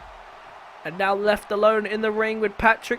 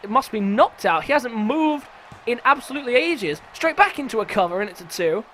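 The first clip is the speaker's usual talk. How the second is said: ordinary talk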